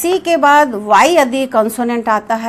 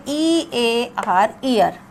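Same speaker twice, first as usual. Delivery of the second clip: slow spelling; 3 dB quieter, much slower than usual. Shown as a demonstration. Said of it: "ई में भी दो उच्चारण होते हैं".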